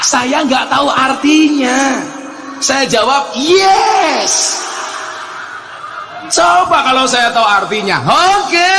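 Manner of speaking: preacher hams up his ignorance; comic outburst. Acted. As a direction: play up the gag for the crowd.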